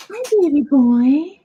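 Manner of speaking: slow high cooing to a pet. Real, not acted. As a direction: coo lovingly at the puppy. Greeting the dog.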